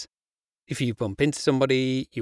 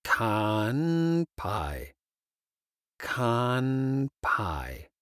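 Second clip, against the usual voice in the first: slow; much slower than usual. Shown as a demonstration. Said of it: "This is if you're drinking drinks".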